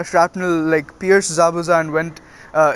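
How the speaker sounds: usual speaking voice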